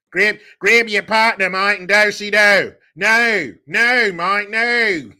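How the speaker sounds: loud, high caricature Aussie; over-the-top Australian holler; mock Australian accent. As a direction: call out like a hammed-up Australian. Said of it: "You're fucking Australian".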